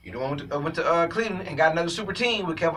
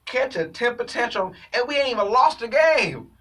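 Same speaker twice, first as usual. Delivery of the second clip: higher, disbelieving teammate voice; incredulous teammates; 7 semitones higher, 3 dB louder than usual. Retold or imitated. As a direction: act like you can't believe what you're seeing. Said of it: "they looked at that and said".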